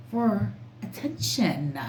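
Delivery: ordinary talk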